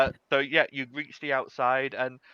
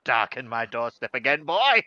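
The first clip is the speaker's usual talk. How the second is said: put-on old halfling grandmother scolding fondly, loud finish; mock scolding that jumps up loud on the last word; Polly; 5 dB louder than usual. Grandmother greets young Xandal at her door, mock scolding. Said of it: "lovely old halfling grandmother called Polly".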